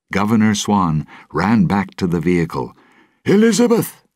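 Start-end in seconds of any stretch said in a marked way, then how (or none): 3.2-4.0 desperate shout, much higher and louder. A narrator's reading. Governor shouts desperately for his missing daughter, loud cry. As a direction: call out for his daughter at full voice. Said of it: "he shouted".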